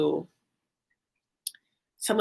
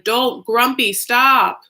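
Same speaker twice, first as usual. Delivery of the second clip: louder, higher mock protest; raised voice, quoting a frustrated boyfriend; retold protest; 4 semitones higher, 7 dB louder, much slower than usual. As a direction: imitate an exasperated plea to stop. Retold quarrel, man protests.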